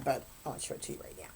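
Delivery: fast, hushed aside under the breath; muttered aside. Real, not acted. Telling viewers she'll show the cover later, hushed and quick. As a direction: drop to a near whisper, rushing it.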